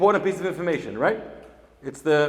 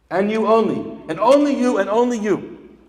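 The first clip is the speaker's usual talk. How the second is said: emphatic build; 6 semitones higher, 8 dB louder than usual. Real, not acted. Rabbi builds emphasis on you.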